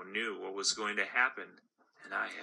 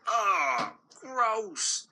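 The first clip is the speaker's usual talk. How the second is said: loud, higher groan of disgust; grossed-out gumball; 11 semitones higher, 4 dB louder than usual. Acted. Gumball stuck in teeth, higher groan of disgust. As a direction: recoil from leftover food.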